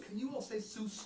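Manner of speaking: ordinary delivery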